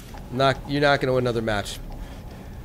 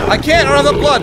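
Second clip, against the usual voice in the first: panicked shout; 11 semitones higher, 7 dB louder than usual. Real, not acted. Panicked reply under attack.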